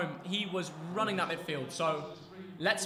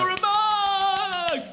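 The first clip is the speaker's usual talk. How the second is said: shout; 10 semitones higher, 8 dB louder, much slower than usual. Real, not acted. Yelling at a player.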